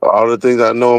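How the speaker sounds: ordinary talk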